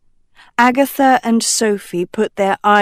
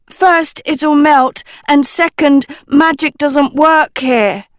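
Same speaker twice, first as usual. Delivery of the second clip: higher, snapping rebuttal; railing; 7 semitones higher, 4 dB louder than usual. Acted. Dot arguing with Hester, railing angrily. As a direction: rail back at her friend. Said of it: "Dot railed".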